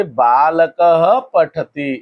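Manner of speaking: बालकः said slowly as "baa-la-ka-ha", then पठति; voiced visarga. Shown as a demonstration. Teacher showing what the visarga does to बालकः, voiced visarga. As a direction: let बालकः end in a clear "ha". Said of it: "विसर्ग बोलने की वजह से".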